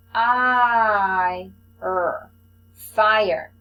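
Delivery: "I", "er", "fire" said loud, one chunk at a time; sounding out syllables. Shown as a demonstration. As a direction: blend the parts of "fire" for a child, loud and separate. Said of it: "Look closely at the word".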